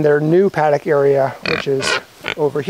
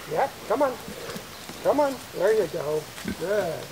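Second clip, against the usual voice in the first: coaxing animals; 11 dB quieter than usual. Real, not acted. Coaxing pigs, high soft.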